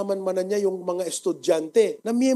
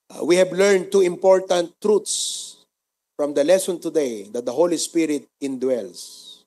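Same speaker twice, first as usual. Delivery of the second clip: steady, earnest prayer voice; 3 dB louder than usual. Acted. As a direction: lead the church in prayer. Closing prayer.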